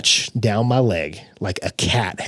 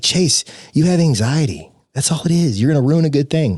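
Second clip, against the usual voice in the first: higher-pitched imitation of a girlfriend; exasperated girlfriend; 6 semitones higher than usual. Girlfriend telling him it's only anxiety, imitated exasperation.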